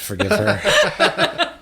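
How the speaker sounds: laughing through the words; giggling apology. Real, not acted. Teasing apology for a friend.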